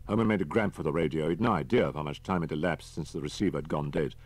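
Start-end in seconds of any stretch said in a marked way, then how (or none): none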